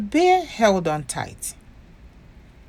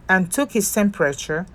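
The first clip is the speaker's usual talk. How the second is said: ordinary delivery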